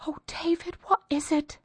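high, thin, panicked plea; a terrified woman clutching at him; frightened wife. Acted. Frightened wife cries to husband.

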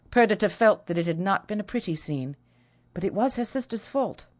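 usual speaking voice

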